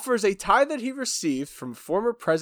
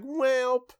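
joking; 9 semitones higher than usual. Retold or imitated. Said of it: "It's like, as a joke".

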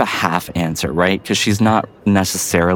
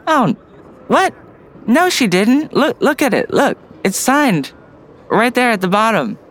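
put-on much higher older woman's voice, slowing on look it's signed; the older guard pointing out the signature, pitched way up; older guard correcting; 13 semitones higher than usual. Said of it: "Danielle looks at Water and goes".

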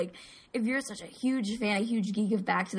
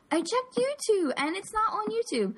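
high-pitched mock complaint; put-on high voice of a complainer; mocking commenter; 12 semitones higher than usual. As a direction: imitate a pestering commenter, pitched way up.

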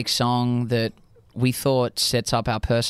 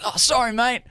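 higher-pitched, hammed-up Aussie apology; hammed-up Australian accent; 10 semitones higher than usual. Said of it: "I, like, hammed up the accent".

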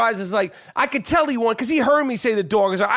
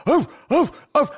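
short, higher-pitched mock barks; imitated dog barking; 7 semitones higher than usual. Man re-enacts barking in the other guy's face, imitated dog barks. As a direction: bark like a dog, in quick separate bursts. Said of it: "I started barking in his face".

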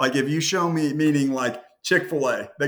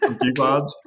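said through a laugh; real chuckle while talking; 3 semitones higher than usual. Real, not acted. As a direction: break into laughter on the punchline. Laughs at own punchline.

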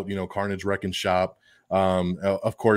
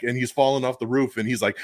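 normal talking voice

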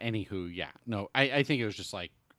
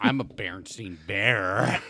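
Fred Norris voice; 8 dB louder, slower than usual. Pretend. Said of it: "Doing, like, a Fred Norris style voice".

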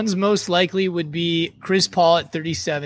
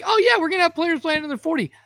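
mocking voice; 11 semitones higher than usual. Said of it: "I'm like".

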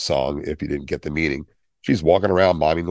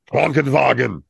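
harsh German; 6 semitones higher than usual. Shown as a demonstration. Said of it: "it's not an angry language".

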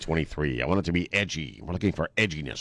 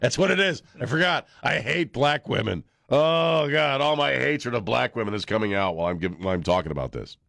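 high, mock-confessing sarcasm; sarcastic; 7 semitones higher than usual. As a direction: play a fake guilty confession.

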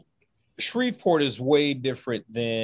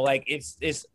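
usual speaking voice

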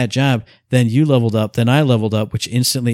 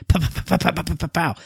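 gunshot sounds; 4 semitones higher, 3 dB quieter than usual. Retold or imitated. Imitating gunshots.

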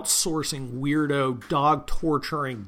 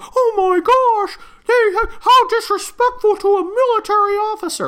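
shrieking mock outrage; 10 dB louder than usual. Mocking outraged critics in a very high falsetto.

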